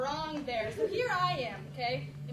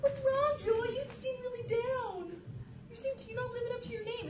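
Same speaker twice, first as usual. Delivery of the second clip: fake-concerned teens; 7 semitones higher than usual. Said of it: "all her friends are like".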